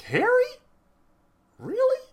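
shocked disbelief. Retold or imitated. Squeal of disbelief. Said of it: "I was like".

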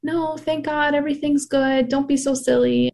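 high, breezy brush-off; dismissive father-in-law. Retold or imitated. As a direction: wave away her fear with cheer. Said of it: "he was just like".

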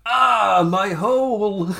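loud, high, drawn-out mock wail; milk the pain like a hammy actor; over-dramatic cry. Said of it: "done it way more dramatically".

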